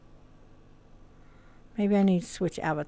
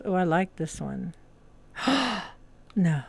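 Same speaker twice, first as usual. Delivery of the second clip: ordinary delivery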